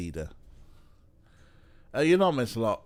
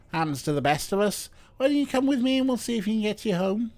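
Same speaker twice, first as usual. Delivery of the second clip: lightly faked Scouse, picking up speed; bad Scouse accent; 10 semitones higher, 4 dB louder, faster than usual. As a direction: offer help in a hint of bad Scouse. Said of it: "I'm doing a bad Scouse".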